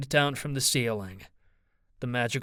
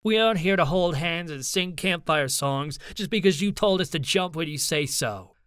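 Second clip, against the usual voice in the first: raised, heated refusal; angry defiance; 5 semitones higher, 4 dB louder than usual. A narrator's reading. Ivy refuses to jump when Charlie says so, angrily. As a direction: keep attacking, pitched up.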